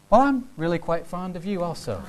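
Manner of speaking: a hammed-up, politely evasive brush-off; a mock polite letdown, in an altered voice; awkward lukewarm reply. A date answering a love confession with lukewarm politeness. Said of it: "And you respond".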